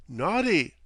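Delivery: mock scolding, calm and sing-song; serene reproach. A narrator's reading. Captain serenely chiding his guest in Cockney. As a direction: scold him like a child. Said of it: "said the captain serenely".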